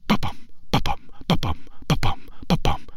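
soft breathy ba-bum; hushed whispered heartbeat. Acted. Feels his own heartbeat.

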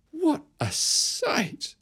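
high, slow astonished cry; high shriek of amazement. Acted. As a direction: gasp it out in wonder. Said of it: "The blacksmith almost shrieks".

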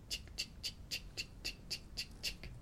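very soft whispered chick hiss; whispered chick sound. Acted. Boy secretly spraying the girl, very soft whispered chicks. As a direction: barely breathe the chicks. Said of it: "The boy would go".

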